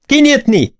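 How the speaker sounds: climbing to a high, commanding shout; shouted order. A narrator's reading. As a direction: demand the door be opened. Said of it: "kiáltott fel Lestrade".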